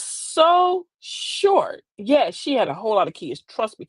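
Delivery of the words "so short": and her skirt was so short, dragged out word by word; slow, teasing emphasis on how short; drawn out for effect. Shown as a demonstration. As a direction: stretch every word slowly to milk the joke. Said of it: "skirt was this short".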